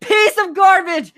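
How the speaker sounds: furious accusation at the GM; real angry rant; angry outburst. Real, not acted. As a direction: lash out in betrayal. Angry outburst at GM over trade.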